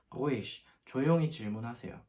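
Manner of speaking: ordinary delivery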